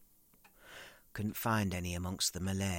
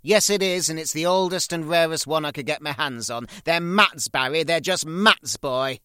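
loud, high furious mother; loud high enraged mother; 7 semitones higher, 12 dB louder than usual. Retold or imitated. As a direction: tear into him as his mother. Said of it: "she says".

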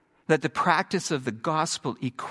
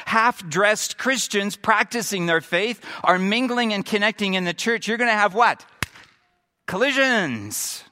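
higher and louder, driving the point home; impassioned preaching; 5 semitones higher, 4 dB louder than usual. Acted. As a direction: preach it to the room. Preaching builds to full force.

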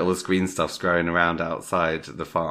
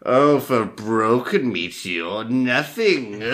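slow, a bit louder mock appraiser; mock professor verdict; 5 semitones higher, 4 dB louder, slower than usual. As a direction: drawl out the bad news like a sweaty professor. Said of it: "he's like".

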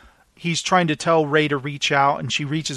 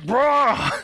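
sad Wookiee roar; 7 semitones higher than usual. Retold or imitated. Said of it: "all sad".

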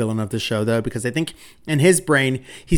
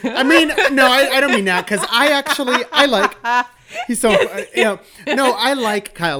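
higher-pitched, protesting through laughter; laughing backpedal; 10 semitones higher than usual. Real, not acted. Co-host walking back a dig at Kyle, laughing. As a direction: laugh while hastily taking it back.

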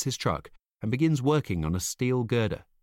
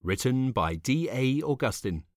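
plain reading voice

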